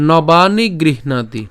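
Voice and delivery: slow নবানি গৃহণাতি, long "baa" and "naa"; deliberate teacher's recitation of নবানি গৃহণাতি; Sanskrit pronunciation demo. Shown as a demonstration. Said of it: "এখানে যেটা উচ্চারণ হচ্ছে".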